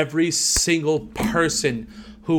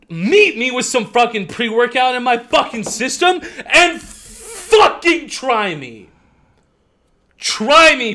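furious rant; 5 semitones higher than usual. Real, not acted. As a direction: let the fury build and spit it out. Gym rant against old man.

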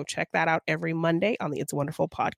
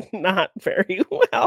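laughing; 2 semitones higher, slower than usual. Real, not acted. Laughing while answering.

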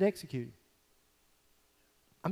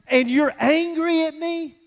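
higher, slow disbelieving question; incredulous; 8 semitones higher, 5 dB louder, slower than usual. Acted. Preacher to angry listener, slow incredulous disbelief. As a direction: stretch out the disbelief.

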